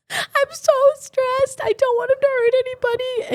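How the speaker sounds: tearful. Retold or imitated. Telling the dog trainer she is stressed, imitated crying in a high voice. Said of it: "burst into tears".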